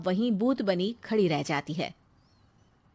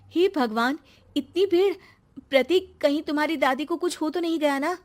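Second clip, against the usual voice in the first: higher and louder, alarmed at the crowd; shocked surprise; 7 semitones higher, 3 dB louder than usual. Acted. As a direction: gasp it out in a startled rush. Preeti shocked at crowded house. (भीड़ देखकर चौंकी हुई, घबराई आवाज़)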